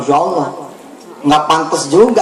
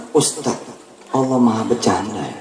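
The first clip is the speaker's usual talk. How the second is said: quiet aside; 10 semitones lower, 6 dB quieter than usual. Retold or imitated. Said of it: "Dia cerita sama saya".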